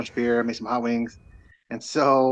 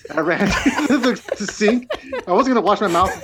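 laughing while telling; 7 semitones higher, 4 dB louder than usual. Real, not acted. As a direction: talk through real laughter, pitched up. Laughing while retelling burning mouth.

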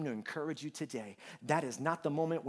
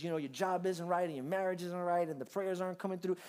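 ordinary delivery

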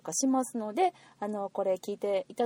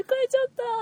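much higher, louder retold glee; mock excited exclamation; 5 dB louder, much faster than usual. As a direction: squeal it lightly as if reliving the win. Acting out delight at getting tickets. (買えた喜びを再現して高い声で)

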